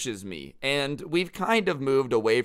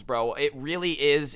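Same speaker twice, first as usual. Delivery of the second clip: ordinary delivery, nothing marked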